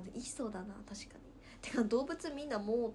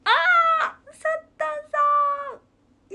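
高く大声で叫ぶ物真似; 本人になりきって甲高く叫ぶ; [name]のモノマネ; 17 dB louder than usual. Pretend. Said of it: "[name]のモノマネお願いします". (甲高い叫び声で[name]の真似)

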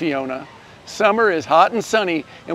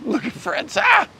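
delighted cooing; 13 semitones higher than usual. Real, not acted. Cooing over baby Fritz in story.

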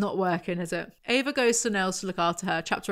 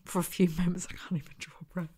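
voiceless, winded whisper; breathless whisper; 5 dB quieter, faster than usual. Acted. Narrator stunned by Matt's words, breathless whisper. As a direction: whisper it as if you can't draw breath. Acts out being winded by shock.

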